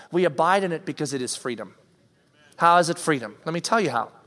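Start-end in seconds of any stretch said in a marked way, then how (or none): none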